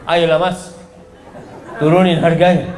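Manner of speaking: sweet coaxing plea to the seller, hint of a laugh; a woman sweet-talking a market seller; flirty haggling wife. Shown as a demonstration. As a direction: wheedle the price down playfully. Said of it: "nggak ada kayak gitu".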